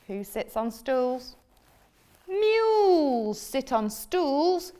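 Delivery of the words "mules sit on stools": slow, high know-it-all cat; bossy cat. Acted. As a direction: lecture the frog slowly. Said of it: "said the cat".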